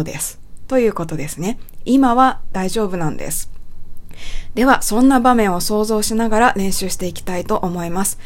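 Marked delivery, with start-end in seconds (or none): none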